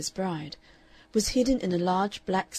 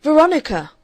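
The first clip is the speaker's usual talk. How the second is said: loud, high warning call; warning cry; 8 semitones higher, 13 dB louder than usual. A narrator's reading. Aunt warning her niece mid-struggle, loud cry. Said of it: "cried Miss Stanley warningly".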